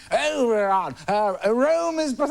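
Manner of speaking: high, slow, grand declaiming; drunk O'Toole. Retold or imitated. O'Toole starting his speech too early, grand and drunk. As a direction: launch into the line like a sozzled lord.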